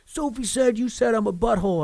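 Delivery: high-pitched mock kid complaint; imitated tattling child. Dad imitating his son reporting to him, tattling. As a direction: lightly voice an aggrieved little boy tattling.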